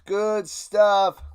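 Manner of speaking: ordinary talk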